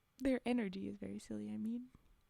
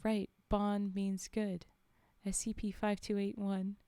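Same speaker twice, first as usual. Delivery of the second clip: ordinary delivery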